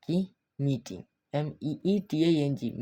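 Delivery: ordinary delivery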